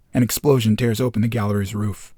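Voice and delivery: ordinary delivery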